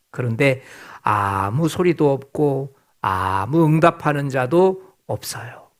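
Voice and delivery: normal talking voice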